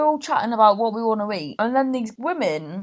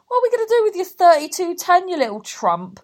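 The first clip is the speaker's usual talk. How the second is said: higher, louder, fast scoffing snap; scornful retort; 8 semitones higher, 5 dB louder than usual. Retold or imitated. Mocking the women's phone claim, a higher, louder scornful retort. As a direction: throw it back with contempt. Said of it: "It's like".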